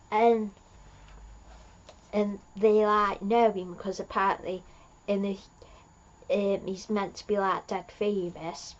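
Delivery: usual speaking voice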